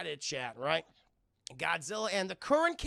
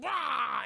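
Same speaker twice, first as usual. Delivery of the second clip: loud mock beam shout; shouted beam sound; laser blast noise; 7 semitones higher, 6 dB louder than usual. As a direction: fire the breath. Imitating atomic breath.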